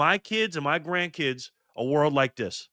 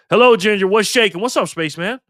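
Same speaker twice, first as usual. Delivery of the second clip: loud excited greeting; 6 semitones higher, 8 dB louder than usual. Real, not acted. Greeting viewer in chat.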